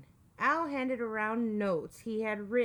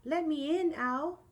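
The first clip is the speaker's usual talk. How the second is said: higher-pitched Mouse voice; calling out; 6 semitones higher than usual. A narrator's reading. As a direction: call at the door, without shouting. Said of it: "called Mouse".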